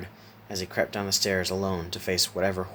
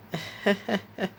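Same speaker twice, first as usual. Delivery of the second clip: high, short character chuckle; 12 semitones higher than usual. A narrator's reading. Laughing Jack joins Isaac's laughter with a chuckle. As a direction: join in with a pitched-up chuckle. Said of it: "began to chuckle as well".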